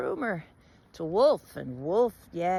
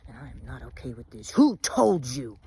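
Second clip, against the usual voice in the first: menacing Bear; 6 semitones lower than usual. Acted. Bear cornering Wolf at his den, low and menacing. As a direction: press Wolf coldly for the source. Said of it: "confronted Wolf".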